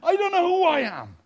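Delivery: hammed-up helpless squeal; comic despair. Footballer who missed an open goal, comic despair. Player's imagined despair after a miss.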